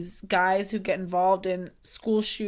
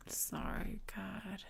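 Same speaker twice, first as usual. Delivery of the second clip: whispered through tears; tearful whisper; 19 dB quieter, much slower than usual. Real, not acted. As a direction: barely get it out, crying. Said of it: "I'm sorry I'm such a mess right now".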